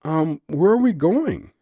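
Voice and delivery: yelping. A narrator's reading. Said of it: "she yelped".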